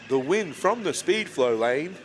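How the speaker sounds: slow, drawn-out hyped announcer; dramatic announcer call. Acted. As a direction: stretch the winner's name for the crowd. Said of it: "You gotta say it in a monotone voice".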